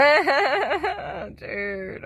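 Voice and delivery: high, rippling laugh; a drawn-out run of chuckles. Real, not acted. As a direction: laugh it out before speaking. Laughs before returning to notes.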